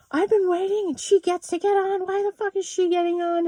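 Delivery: high mocking rude passenger voice; angry passenger. Rude passenger complaining to the pilot, whiny high mock voice. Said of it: "This fucking guy was like".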